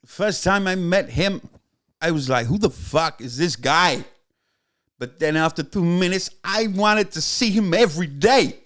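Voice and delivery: slow, emphatic impression that drifts into Christopher Walken; hammed-up storyteller impression; Tony Yester. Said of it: "it's meant to be Tony Yester".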